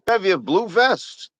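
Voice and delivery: put-on high old-timey newsreel announcer; 1950s narrator. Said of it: "the nineteen fifties announcer, old-timey voice".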